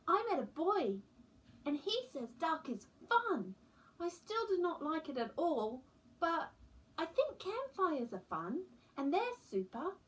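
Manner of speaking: a chick excitedly reporting back to mum; little owlet voice. Acted. Plop voiced in story.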